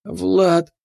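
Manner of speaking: high, pleading call; desperate appeal. A narrator's reading. Tearful appeal to Влад.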